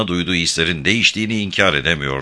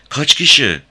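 insistent question; 7 semitones higher, 5 dB louder than usual. A narrator's reading. Louisa repeats her question, horrified.

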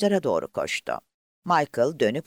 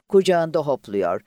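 usual speaking voice

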